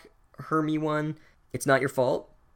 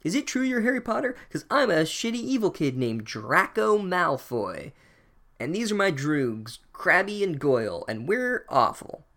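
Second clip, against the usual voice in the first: put-on smug evil kid; 6 semitones higher than usual. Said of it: "he's like".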